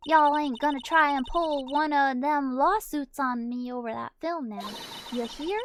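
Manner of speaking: lightly voiced southern woman, threatening; southern accent. Lottie warning them off a lawsuit, southern accent. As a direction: act tough in a southern accent. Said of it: "she is hard to do".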